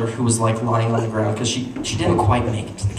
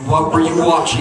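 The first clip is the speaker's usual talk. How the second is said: loud, raised accusing demand; angry mom; 4 semitones higher, 6 dB louder than usual. Retold or imitated. Mom interrogating kids after scriptures, loud anger. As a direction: imitate a mother losing her patience. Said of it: "had fire in her eyes".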